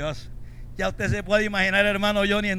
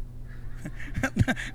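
soft breathy laugh; 9 dB quieter than usual. Real, not acted. Laugh after whispered quote.

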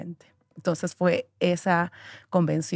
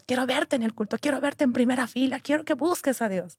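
higher insistent demand; stern sister; 6 semitones higher than usual. Retold or imitated. Church sister demanding she come to the service, higher. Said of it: "porque me dijo".

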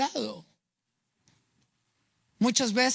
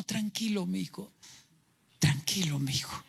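soft, low hush of comfort, fading to a whisper; tender comfort; 4 semitones lower, 6 dB quieter than usual. Acted. Forgiving father hushing his ashamed son, softly. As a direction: soothe him gently, almost whispering.

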